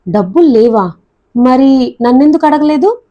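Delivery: plain reading voice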